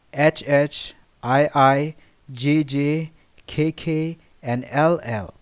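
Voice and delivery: each letter called out loud and separate; calling out rhyme letters. Shown as a demonstration. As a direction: call out the rhyme scheme letter by letter, loudly. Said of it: "The poem is constructed in rhyming couplets".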